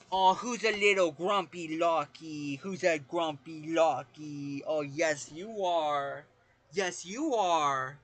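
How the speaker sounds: very slow, drawn-out cooing; baby talk. Pretend. Teasing grumpy Lockie, exaggerated baby talk. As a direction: coo teasingly as if to a pet.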